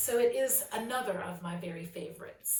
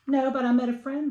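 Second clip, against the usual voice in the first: higher, louder, proud; happy child; 7 semitones higher, 11 dB louder than usual. Acted. Sally tells her mom about her friend, higher and louder. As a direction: answer proudly. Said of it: "said Sally".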